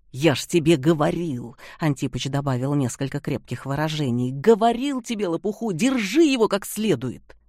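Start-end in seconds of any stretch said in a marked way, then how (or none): 0.1-1.6 angry. Acted. Angry after dropping box. 4.3-7.4 higher, louder angry scold. Acted. Antipov swears at partner.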